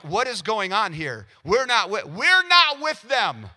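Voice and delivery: high, slow, panicky bystanders backing away; mock panic. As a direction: pull back like a frightened onlooker. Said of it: "and so they're all like".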